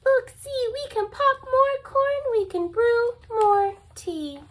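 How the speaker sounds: high, eager squeak; a little mouse cheering the bear up; squeaky mouse. Acted. Mouse squeaking to the sad bear to comfort him. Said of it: "Mouse squeaks".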